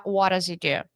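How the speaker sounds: What does he do? linked into wodazide; connected speech. Shown as a demonstration. Teacher showing students how sounds link. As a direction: run What does he do? together.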